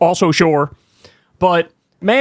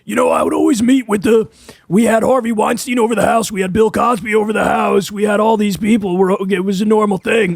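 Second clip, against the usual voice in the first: imitation of a podcast guest, pitched slightly up; mock RFK; 4 semitones higher than usual. Imitating RFK rambling on a podcast, lightly mocking. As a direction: put on a slightly higher mocking voice. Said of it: "he was like".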